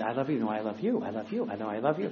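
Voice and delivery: competing spouses. Acted. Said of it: "there's gotta be that competition".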